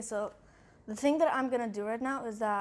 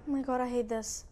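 ordinary talk

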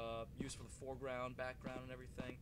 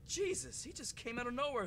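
higher, louder rattled outburst; shaken exclaiming; 10 semitones higher, 7 dB louder than usual. Real, not acted. Gamer telling viewers about the scare, shaken outburst. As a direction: still reeling from the scare.

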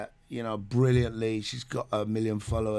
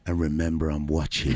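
mock warning; 8 semitones lower than usual. Dad warning daughter.

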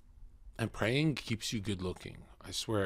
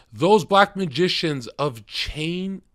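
dramatic reading; 7 semitones higher, 9 dB louder, slower than usual. Acted. Dramatic slow reading aloud.

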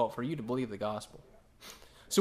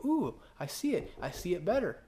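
impressed viewer; 4 semitones higher than usual. Retold or imitated. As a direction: coo like a convinced viewer. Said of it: "You go".

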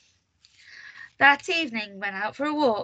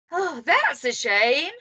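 disappointed; 4 semitones higher, slower than usual. A narrator's reading. Miss Bossy regrets leaving.

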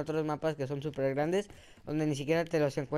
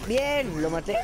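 higher and louder cheer of Bien; triumphant cheer; 4 semitones higher, 5 dB louder, slower than usual. Real, not acted. Gamer cheering to viewers after a kill, higher and louder. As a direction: celebrate the kill out loud.

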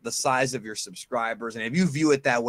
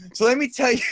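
wrestling promo; 9 semitones higher, 6 dB louder, faster than usual. Pretend. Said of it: "I'm gonna do a promo over again".

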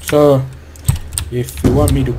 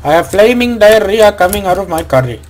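higher, louder put-on Indian accent; mock Indian accent; 4 semitones higher, 3 dB louder than usual. Player announcing curry mode, mock Indian accent. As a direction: exclaim in an exaggerated Indian accent. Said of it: "any Indians out there".

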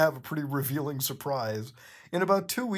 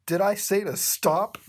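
higher, louder sassy question; teasing Bendy; 5 semitones higher, 5 dB louder than usual. Acted. Reader voicing Bendy teasing Cuphead, higher sassy. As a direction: tease like a cartoon.

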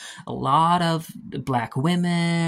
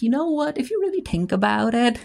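much higher, giddy buildup to a joke; gleeful realization; 10 semitones higher than usual. Real, not acted. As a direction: squeal it out like you just cracked the secret. Gleeful realization at sex scene.

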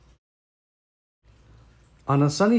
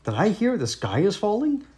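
lightly voiced duck, pitched higher; a higher, questioning duck voice, rising at the end; quacking duck; 7 semitones higher than usual. A narrator's reading. Ducky Lucky asks about the falling sky, lightly quacking. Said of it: "quacked Ducky Lucky".

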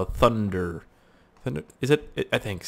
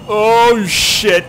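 high, loud startled swear; real startled yelp; alarmed outburst; 10 semitones higher, 9 dB louder than usual. Real, not acted. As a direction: blurt it out as the plane lurches. Plane nearly crashes.

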